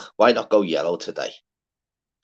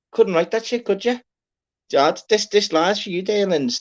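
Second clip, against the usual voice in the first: Couldn't write that shit said in a far higher, squeaky put-on voice; squeaky mock amazement; 12 semitones higher than usual. Host marvelling at a Quavers pun in a high squeak.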